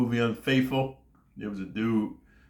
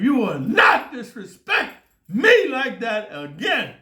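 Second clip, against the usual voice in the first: slow, high, loud furious yell; scream; 11 semitones higher, 6 dB louder, slower than usual. Retold or imitated. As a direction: scream it out, dragging every word. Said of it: "as you scream".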